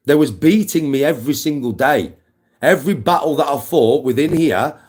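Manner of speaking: loud, high-pitched impassioned shouting; furious rant. Real, not acted. Telling doubters he now beats his emotions daily, furious shouting. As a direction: hammer each phrase out at full force.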